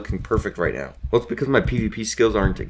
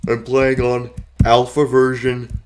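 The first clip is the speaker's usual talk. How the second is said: altered voice; 3 semitones higher, 4 dB louder than usual. Acted. Altered voice in old version.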